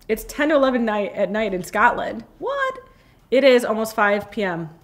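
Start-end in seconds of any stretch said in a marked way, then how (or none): 2.4-2.8 surprised. Real, not acted. Surprised by the time.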